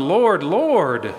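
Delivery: zealous quoted plea; enthusiastic. Retold or imitated. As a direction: cry out Lord twice with zeal. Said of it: "it's very enthusiastic".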